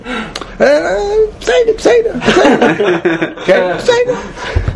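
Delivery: high, laughing, stretched-out hesitant squeal; mock hesitation. Shown as a demonstration. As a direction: pretend to mull it over, squealing. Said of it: "make it look as if you're going".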